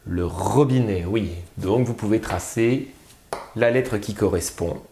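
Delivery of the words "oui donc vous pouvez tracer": ordinary talk, nothing marked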